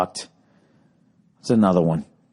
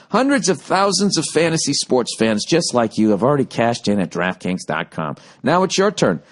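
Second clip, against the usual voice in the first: upbeat sponsor read, pitched up; 6 semitones higher than usual. Acted. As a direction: sell it like a radio spot. Reads DraftKings ad.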